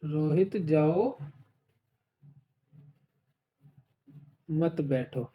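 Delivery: long pause after जाओ, then low मत बैठो; comma after जाओ. Shown as a demonstration. Teacher shows students where the comma falls, pausing after जाओ. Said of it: "एक में coma यहाँ है".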